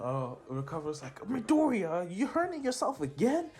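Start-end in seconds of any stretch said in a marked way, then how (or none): 1.3-3.5 high-pitched exasperated scold; scolding healer. Retold or imitated. Recovery Girl to injured Midoriya, high-pitched scolding. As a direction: scold him in a high character voice.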